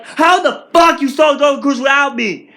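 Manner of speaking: loud, high, outraged question; angry outburst. Retold or imitated. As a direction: yell it at the family who went without you. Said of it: "my reaction was like".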